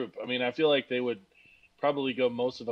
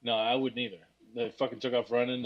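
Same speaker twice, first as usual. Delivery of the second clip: ordinary delivery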